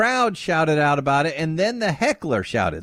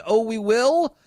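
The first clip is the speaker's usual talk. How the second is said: retold scream; 6 semitones higher, slower than usual. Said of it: "supporter screams".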